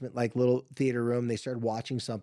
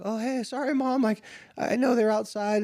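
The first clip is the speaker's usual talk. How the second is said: retold daughter apology; 8 semitones higher than usual. Daughter apologising to arriving mom.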